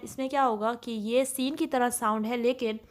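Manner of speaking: usual speaking voice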